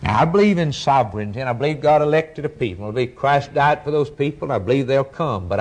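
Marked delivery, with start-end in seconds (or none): none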